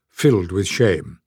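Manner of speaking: ordinary delivery, nothing marked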